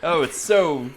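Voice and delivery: gushing mock-British sports fan; hammed-up English accent, savouring the last words. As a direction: play a delighted Brit who barely knows baseball. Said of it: "Americans who have British accents".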